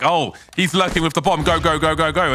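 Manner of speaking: eager go go go; urgent player callout. Retold or imitated. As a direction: hurry the team on. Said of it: "He's like".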